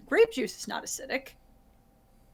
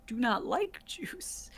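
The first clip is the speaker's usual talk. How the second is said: whispered aside to the audience; conspiratorial aside; 9 dB quieter, slower than usual. Real, not acted. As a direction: drop to a secret whisper. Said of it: "an aside to the audience".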